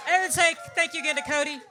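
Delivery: loud, fast, high shout to the crowd; rally the whole room to thank Cody; crowd call-out. Real, not acted. Comedian on stage urging the crowd to thank Cody, loud shout.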